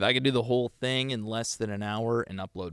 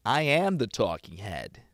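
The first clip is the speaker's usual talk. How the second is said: ordinary talk, nothing marked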